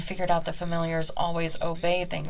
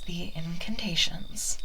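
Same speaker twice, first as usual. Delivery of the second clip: whisper; slower than usual. Acted. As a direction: whisper the word incantations. Witch whispers the key word.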